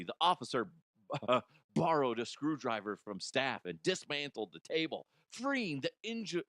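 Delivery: normal talking voice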